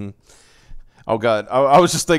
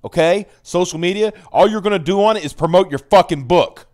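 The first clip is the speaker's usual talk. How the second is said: louder, blunt boss pep-talk; boss laying down law; 5 semitones higher, 6 dB louder than usual. Retold or imitated. Marvel boss tells writers the social-media rule, loud. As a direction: bark the new rules like an editor in chief. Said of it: "basically say".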